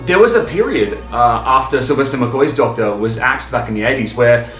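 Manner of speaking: ordinary talk, nothing marked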